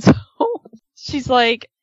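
ordinary talk

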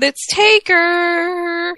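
high, slow excited cry; excited squeal. Real, not acted. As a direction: gush like a thrilled fan.

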